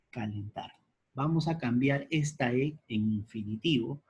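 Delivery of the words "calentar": calentar said soft and low, almost to oneself; quiet repeat. Shown as a demonstration. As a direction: murmur calentar back as a quiet aside. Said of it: "Tenemos aquí la E". (calentar, repetido en voz baja)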